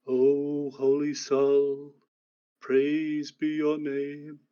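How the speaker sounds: slow, solemn chant to the sun; intoning prayer. Acted. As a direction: intone it slowly, like a priest greeting the dawn. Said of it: "hear a voice intoning in the distance".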